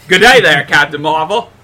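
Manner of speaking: hammed-up Aussie greeting with a loud opening; mock Australian accent. Mocking a Skrull who talks like an Australian, mock Australian accent. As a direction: put on a jokey Australian accent. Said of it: "this Skrull has a thick Australian accent".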